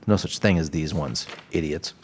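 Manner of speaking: muttered under the breath, playfully; mock insult, muttered low. Host teasing listeners about grammar, quiet mock insult. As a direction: drop the voice low and soft for a teasing jab. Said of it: "Just kidding".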